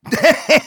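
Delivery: big, high burst of laughter; roaring laugh. Acted. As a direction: let out a loud roar of laughter. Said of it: "roared with laughter".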